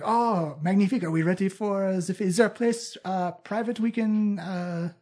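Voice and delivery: lightly voiced French accent; a hint of a French dandy. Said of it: "It's like".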